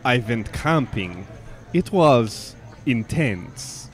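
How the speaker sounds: slow deadpan. Acted. Accent game deadpan joke.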